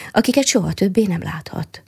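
ordinary delivery

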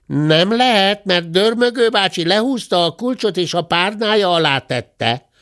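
high-pitched, slightly peeved cub; annoyed bear cub. A narrator's reading. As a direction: answer high and put out. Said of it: "felelt bosszúsan a bocs".

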